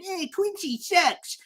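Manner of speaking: high-pitched, drawn-out mock jeer; mocking quote. Sports host quotes his own critical article, mocking. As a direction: stretch it out high, quoting the knock.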